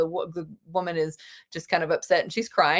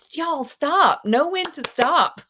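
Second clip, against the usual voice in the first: higher, pleading mock scold; exasperated plea; 7 semitones higher, 3 dB louder, slower than usual. Teacher mocking film studios, exasperated. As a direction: tell them firmly to quit. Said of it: "It's like".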